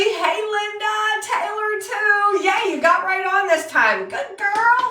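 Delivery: high, loud, eager welcomes; excited greetings. Real, not acted. Host cheering viewers as they join, high and loud.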